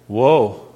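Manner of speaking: woe drawn low and heavy; different emphasis on woe. Acted. Preacher reading scripture, stressing woe. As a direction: lean hard on 'Woe'. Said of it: "I put a little different emphasis on that".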